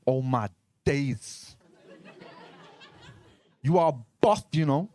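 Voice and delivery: slow, cocky London roadman patter. Acted. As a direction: chat her up in London roadman slang. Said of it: "Adam was from London. He was a road man".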